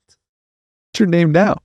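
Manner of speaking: a retold, drawn-out gasp of surprise; astonished question. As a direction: ask it slowly, stunned by the name. Stunned question to the woman.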